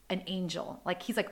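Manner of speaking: usual speaking voice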